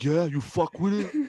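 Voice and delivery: high, slow, drawn-out mock hype; exaggerated high-pitched teasing; hammed-up hyping. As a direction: play up asking mom if she likes it. Hammed-up retelling of quote to mom.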